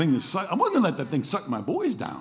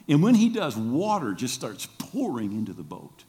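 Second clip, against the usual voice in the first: ordinary talk, nothing marked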